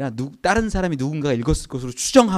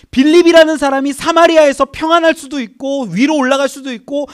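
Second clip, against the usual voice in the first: high, loud preaching climax; fervent preaching; 10 semitones higher, 8 dB louder than usual. Real, not acted. Preacher announcing the work of the Holy Spirit, fervent and loud. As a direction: proclaim it with full force.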